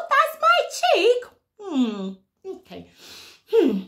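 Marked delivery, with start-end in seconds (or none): 0.1-1.2 high, bright mock surprise; overbright kids party host. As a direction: squeal it for toddlers.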